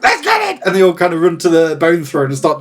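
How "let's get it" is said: squeaky rat voice. Acted. Game master voicing the rat leader answering the party, high squeak. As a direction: pipe it up like a tiny rat. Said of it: "And they're like".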